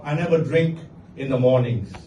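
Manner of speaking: ordinary talk, nothing marked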